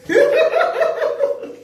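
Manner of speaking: shrieking high laugh. Real, not acted. Laughing at the viewers she mocks. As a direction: burst out in a high cackle.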